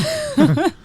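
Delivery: high-pitched burst of laughter. Real, not acted. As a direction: break into a loud high laugh. Laughing at joke.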